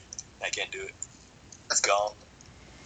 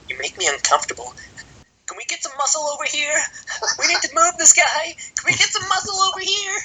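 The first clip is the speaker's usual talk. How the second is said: very high-pitched, raised-voice comic impersonation; mock a panicked call for security, pitched way up; put-on accent; 5 dB louder than usual. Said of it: "that was the best accent ever".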